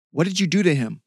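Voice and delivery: loud, high, accusing shout; angry demand. A narrator's reading. Michael rounds on the woman, shouting angrily. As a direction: demand an answer, furious.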